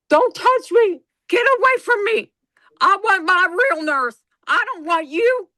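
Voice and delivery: high, loud, frantic protest; high whining mock protest at husband; whiny upset patient. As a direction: push him away like a cranky patient. Retold fight with husband as patient.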